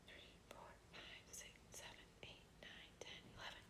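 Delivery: counting in a whisper. Shown as a demonstration. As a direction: whisper the numbers under your breath. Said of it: "whisper count".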